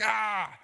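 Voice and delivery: a short high cartoon "Ah!" of being choked; cartoon choking yelp. Preacher voicing a choked cartoon servant, high yelp. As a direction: squawk like a cartoon character being throttled.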